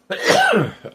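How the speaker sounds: harsh hacking cough; smoker's cough. Real, not acted. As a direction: cough hard, chesty and rough. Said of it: "it's my smoker's cough".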